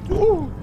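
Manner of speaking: very high, loud "ooh"; sudden high shriek; high startled whoop. Real, not acted. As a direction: yelp it in alarm. Startled by a shark.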